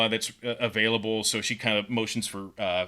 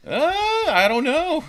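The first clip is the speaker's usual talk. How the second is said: very high, loud drawn-out "uh"; hammed-up squeal of not knowing; exaggerated uncertainty; 7 dB louder than usual. Storyteller dodging a question about her lineage, exaggeratedly high and unsure.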